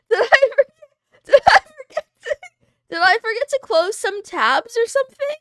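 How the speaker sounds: high, loud, laughing through the words; flustered laughing. Real, not acted. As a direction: laugh while panicking about it. Panicked that her screen was exposed.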